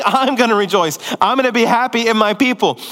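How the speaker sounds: loud happy voice of God; God rejoicing. Retold or imitated. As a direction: proclaim it like a delighted father. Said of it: "God is saying".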